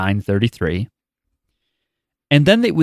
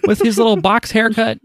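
doting outcry; 10 semitones higher, slower than usual. Real, not acted. Fond mock outcry.

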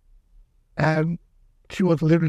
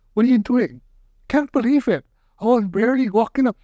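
higher, disbelieving can't believe it; higher, mock-astonished exclaim; amazed; 7 semitones higher than usual. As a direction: marvel that the pain is gone. Student amazed hip healed.

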